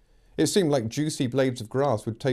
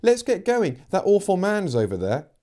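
put-on urgent girl's voice; 8 semitones higher, 4 dB louder than usual. Amy urging, frightened.

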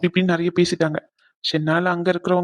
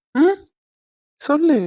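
ordinary delivery

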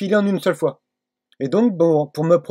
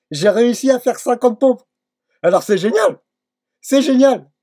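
higher and louder, bursting with pride; excited; 6 semitones higher, 4 dB louder than usual. Real, not acted. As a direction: let the joy spill over. Proud of finishing fifty push-ups.

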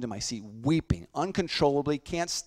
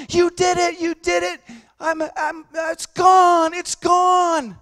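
much higher, loud joyful yell; ecstatic shout; 14 dB louder, slower than usual. Retold or imitated. Healed mother shouting at the boy, ecstatic shout. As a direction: scream it in sudden healed joy.

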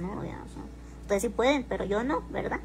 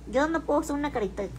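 ordinary talk, nothing marked

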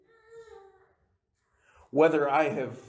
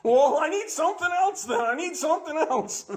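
mock whiny plea; 4 dB louder than usual. Mock prayer asking for more.

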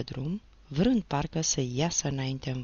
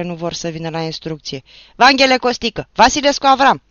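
much higher, loud drill commands; parade-ground shout; 5 semitones higher, 12 dB louder than usual. Acted. The teacher commands the company, shouting. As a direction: bark it like a drill sergeant.

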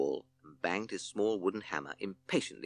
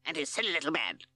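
higher, haughty crow lawyer; 12 semitones higher than usual. Acted. Q C Crow defends the snake to the judge, haughty and higher. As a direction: sneer it like a smug lawyer bird.

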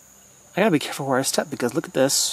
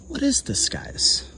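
whisper; 11 semitones higher, 5 dB quieter than usual. Real, not acted. Hushed in the dark jungle.

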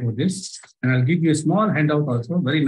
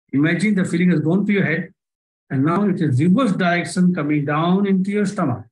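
starting measured, then building higher and faster; guided visualization speeding up; 3 semitones higher than usual. Acted. Hypnotist driving the audience's feeling to spin faster and faster, building pace. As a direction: push the listener faster and faster into the spin.

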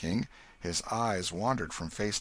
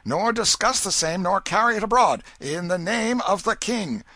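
loud, high crier's announcement; booming public proclamation, much higher; herald's proclamation; 11 semitones higher, 9 dB louder than usual. Acted. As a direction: cry it out like a royal herald. Royal proclamation read out.

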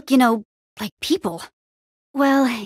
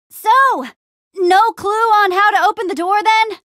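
high, loud, stretched-out call; 9 semitones higher, 4 dB louder than usual. Acted. Asking someone up high about the door, calling out. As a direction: project questions to someone far above.

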